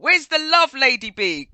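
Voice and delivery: high, loud teasing complaint; mock-offended radio shoutout; mock hurt. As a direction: playfully scold a missing listener. Mock complaint to listener on air.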